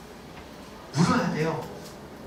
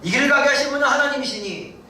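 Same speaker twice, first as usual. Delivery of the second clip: proclaiming; 7 semitones higher than usual. Shown as a demonstration. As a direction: declare it with full conviction. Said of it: "선포하고, 선포하고". (힘차게 선포하듯)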